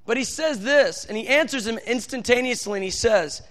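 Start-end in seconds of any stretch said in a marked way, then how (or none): none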